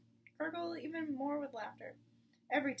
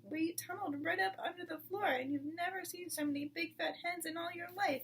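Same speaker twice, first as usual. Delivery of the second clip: put-on higher, breathless child voice; excited small fox; 6 semitones higher than usual. Small fox boasting to mother, excited. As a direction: tumble out the boast.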